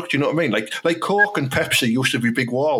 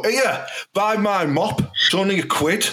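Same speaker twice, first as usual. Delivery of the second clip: raised-pitch, blunt mock sales plug; product owner's pitch; 6 semitones higher than usual. Mop maker selling on advert, high blunt pitch. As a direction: pitch it high like a no-nonsense seller. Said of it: "the owner of the product on there and go".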